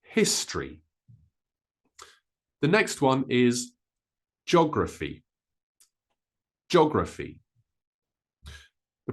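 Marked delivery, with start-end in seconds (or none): 0.0-0.8 history said as HIS-tree; two syllables in history, the O dropped; O disappears. Shown as a demonstration. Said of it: "that O actually disappears a little bit". 4.5-7.4 geography as JOG-ruh-fee; three syllables. Shown as a demonstration. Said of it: "more like three syllables than four syllables".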